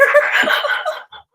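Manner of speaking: giggling at her own joke; high breathy laugh. Real, not acted. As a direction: burst of high airy laughter.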